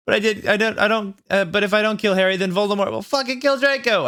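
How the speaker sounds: high, fast, frantic rant. Acted. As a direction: blurt it all out in a panic. Sobbing teen tells her bandmate.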